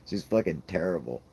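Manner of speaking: usual speaking voice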